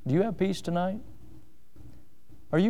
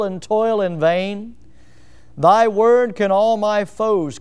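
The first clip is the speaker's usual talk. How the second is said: slow, louder reading of a psalm hymn, each line weighed; solemn hymn reading; 2 semitones higher, 4 dB louder, slower than usual. Acted. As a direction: read the hymn verses with grave pleading. Reads Isaac Watts' psalm hymn.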